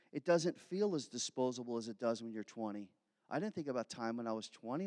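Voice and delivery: normal talking voice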